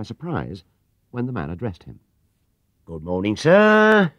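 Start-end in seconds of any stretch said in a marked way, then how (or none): 2.9-4.1 fawning, with sir swooping high and loud; smarmy and eager to sell; oily salesman greeting. Acted. Coach-tour clerk greeting a customer, fawning. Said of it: "rubbing his hands with invisible soap".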